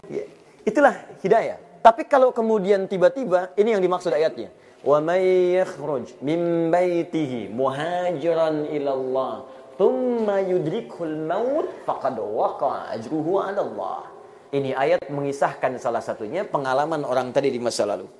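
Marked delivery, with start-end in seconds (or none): 4.8-14.0 slow, drawn-out chanted recitation of the verse; measured Quranic chant inside a sermon. Acted. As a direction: recite it like tilawah, stretching each vowel.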